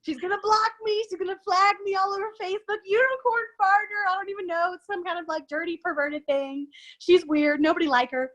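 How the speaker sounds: high, hammed-up fretting voice; mock spiralling panic. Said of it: "I was like".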